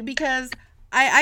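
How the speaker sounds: ordinary talk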